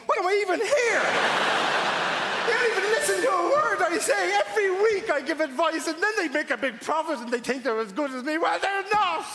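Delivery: high-pitched, hammed-up exasperated yelling; exasperated off-camera rant. Acted off-camera rant of TV presenter.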